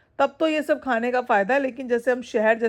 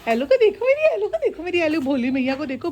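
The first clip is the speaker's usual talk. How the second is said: put-on baby talk; 5 semitones higher than usual. Showing off the dog.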